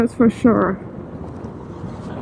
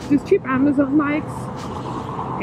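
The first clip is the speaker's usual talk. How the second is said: slow and muffled in a helmet; slow muffled repeat; 2 semitones higher, 7 dB quieter, much slower than usual. Real, not acted. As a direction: say it again slowly through the helmet. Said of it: "I have to adjust my helmet".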